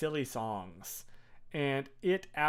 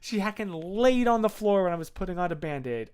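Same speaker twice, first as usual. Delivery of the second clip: ordinary talk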